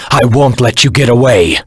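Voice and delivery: loud, high call after a fleeing foe; shouted threat; defiant shout. Acted. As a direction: yell it after him as he escapes.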